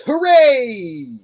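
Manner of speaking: high, loud silly cheer; mock cheer. Saul celebrating the truce, hammed-up cheer. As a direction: cheer like a cartoon.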